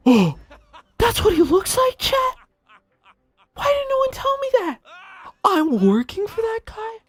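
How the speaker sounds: very high outraged shriek with a laugh; shocked shriek. Real, not acted. Streamer learning his boss's face, shrieking in disbelief. Shocked discovery shouted to chat.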